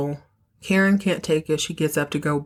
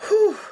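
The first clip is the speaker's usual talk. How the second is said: high relieved whew; 4 dB louder than usual. Real, not acted. Relief after tense scene.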